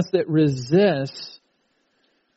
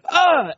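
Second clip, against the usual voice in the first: imitating a child's frustrated cry; short high mock yelp of frustration; frustrated yell; 13 semitones higher, 4 dB louder than usual. Frustrated child yanking shoelaces.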